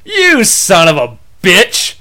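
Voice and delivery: cheeky slow insult to a buddy; mock angry insult. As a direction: hurl it at a friend, stretched out. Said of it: "I go".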